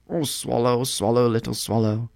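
pleading prince. A narrator's reading. Prince begs swallow to stay another night, pleading. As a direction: coax the bird without raising volume.

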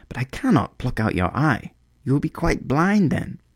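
high, worried bird voice; a small bird refusing out of love; high anxious little bird; swallow. Acted. Swallow protests.

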